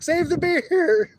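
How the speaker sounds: high, slow, drawn-out panicked cry; frantic yell. Retold or imitated. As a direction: yell it like the beers are floating away. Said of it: "He's like".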